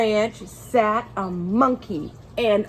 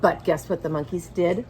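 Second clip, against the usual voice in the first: plain reading voice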